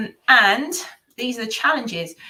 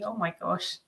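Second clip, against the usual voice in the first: usual speaking voice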